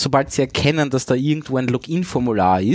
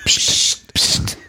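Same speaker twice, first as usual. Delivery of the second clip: unvoiced hissed psst, twice; mock-secretive double hush; playful shush. Hushing friends over a bug in the cellar, playful shush.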